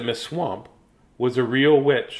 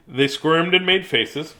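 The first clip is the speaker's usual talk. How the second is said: ordinary delivery, nothing marked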